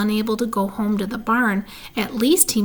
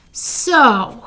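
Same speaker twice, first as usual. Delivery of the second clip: loud, scornful drawn "so"; disgusted; 3 semitones higher, 6 dB louder than usual. A narrator's reading. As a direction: sneer it out in disgust. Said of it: "he said in disgust".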